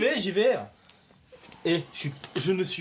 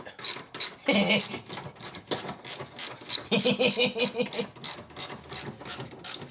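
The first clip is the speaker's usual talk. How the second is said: breathy laughter; 6 semitones higher, 3 dB quieter than usual. Real, not acted. After success.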